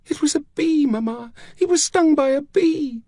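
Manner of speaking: put-on very high little girl's voice; high-pitched, pleading child; distraught child. A narrator's reading. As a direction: blurt it out as an upset child. Distraught child tells of father's death.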